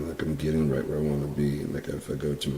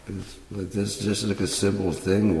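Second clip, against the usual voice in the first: ordinary delivery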